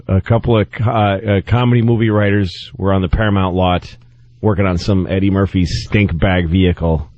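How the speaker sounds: ordinary talk, nothing marked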